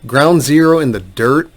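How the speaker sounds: doubting repeat, turned into a question; incredulous echo. Retold or imitated. Lawyer reacting to the adviser's bleak claim, incredulous. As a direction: echo the quote as if you can't believe it.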